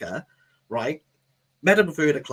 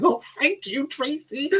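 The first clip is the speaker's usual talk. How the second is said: high-pitched gushing gratitude; gushing thanks; 12 semitones higher than usual. Retold or imitated. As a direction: thank the boss in a high, over-grateful voice. Acted grateful reply to his manager.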